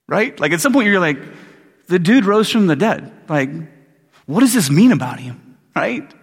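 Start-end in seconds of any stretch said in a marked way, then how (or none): 1.9-5.6 emphatic, mock-amazed retelling; astonished question. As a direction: press the question in disbelief. Voices someone astonished, pressing the question.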